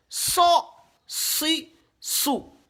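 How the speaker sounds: "So", "si", "sou" brief and separate; high, spaced short syllables "So, si, sou"; short vowels. Shown as a demonstration. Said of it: "les voyelles brèves".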